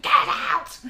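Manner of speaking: loud, high mock yell; sudden comic shout imitating him; yelled order. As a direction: bellow it as the irate boss, in jest. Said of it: "He's like".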